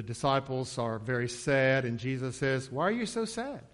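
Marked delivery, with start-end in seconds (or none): 2.7-3.6 high, light, curious question; gently playful mock puzzlement; feigned innocence. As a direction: ask as if you had no idea. Acting Jesus questioning sad disciples innocently.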